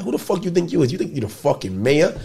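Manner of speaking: high, teasing fake indignation at his friend; needling him like he's full of himself; mock outrage. Friend in the car mocking him, high teasing outrage.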